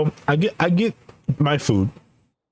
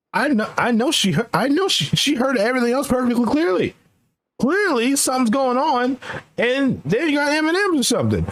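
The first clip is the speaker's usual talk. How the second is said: indignant; 10 semitones higher than usual. Real, not acted. Indignant rant about the order.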